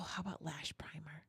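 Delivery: soft unvoiced whisper suggestion. Real, not acted. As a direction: whisper the idea. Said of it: "Why are we whispering?".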